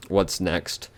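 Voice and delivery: usual speaking voice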